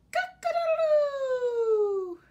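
high, loud, slow crowing; rooster crow. Shown as a demonstration. Showing children the rooster, a loud crow.

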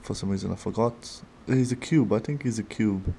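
ordinary talk

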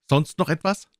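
high-pitched, testy question; high squire voice, put out; impatient squire. Acted. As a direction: ask it sharply, pitched well up. Squire impatient with the captain's demands.

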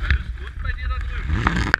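loud call across the distance. Real, not acted. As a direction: shout it over to them. Calling across to someone.

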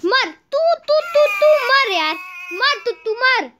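loud, high gamer rage; furious cursing. Real, not acted. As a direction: yell it in fed-up fury. Rages at the character. (गुस्से में चीखकर कोसना)

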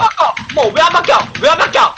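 high, panicky calling out; flustered outcry. Real, not acted. Flustered when game won't switch. (당황해서 높게 외치기)

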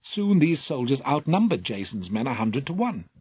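usual speaking voice

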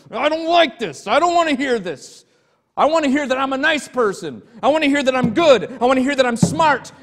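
high, loud indignant ranting; angry complaint. Retold or imitated. Pastor voicing an offended listener who demands praise: loud, high, indignant. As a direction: rant back at the pastor, half mocking the listener's outrage. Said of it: "'Cause he's angry".

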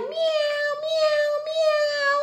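very high little mews; kitten meowing. Pretend. Teacher showing children a playing kitten, high meows. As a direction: mew like a playful kitten. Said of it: "Look, the kitten's playing".

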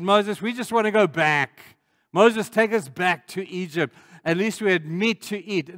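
whining complaint. Retold or imitated. The children of Israel complaining to Moses, mock whine. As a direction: whinge like a spoiled child wanting to go back. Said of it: "they were whining".

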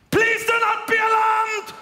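put-on very high, slow shouted German-accented announcement; German pilot accent. German pilot on the PA during turbulence, high mock-German shout. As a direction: bark the reassurance like a German pilot. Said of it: "He's like".

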